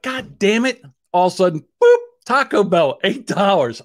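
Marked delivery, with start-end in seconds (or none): 0.0-0.8 frustrated curse. Retold or imitated. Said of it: "I'm like".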